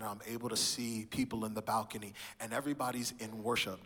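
usual speaking voice

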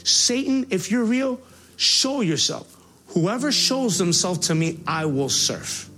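slow, high, shouted dare; defiant challenge. Retold or imitated. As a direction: call out to God and Satan, daring them. Challenging God in anger.

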